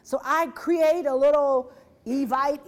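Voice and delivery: usual speaking voice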